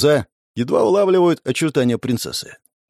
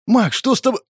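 cartoon princess; 9 semitones higher than usual. Princess calls anxiously.

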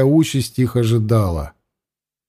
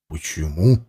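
surprised; 10 semitones lower, 4 dB quieter than usual. A narrator's reading. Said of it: "удивлённо спросил Варлыга".

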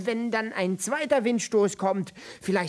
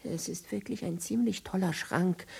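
normal talking voice

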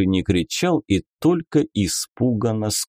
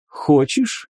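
higher, teasing lady; lady inquisitor voice; 6 semitones higher than usual. Acted. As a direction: play a coy, mocking lady. Beatrisa offers a pie. (игривая дама-инквизитор)

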